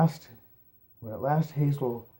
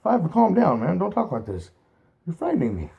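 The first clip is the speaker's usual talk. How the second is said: higher, anxious pleading; shaken; 7 semitones higher, 7 dB louder than usual. A narrator's reading. Hazel to Fiver, higher anxious plea. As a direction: beg him to stop, unnerved.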